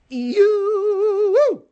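long, high, loud held "you"; wild outburst. Pretend. Host lets out some insanity, long loud wail. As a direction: let one word howl out on a steady note.

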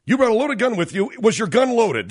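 high, loud mock panic; host mocking Matthews in a shrill panic; mocking panicked anchor. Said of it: "whatever the hell it was he said".

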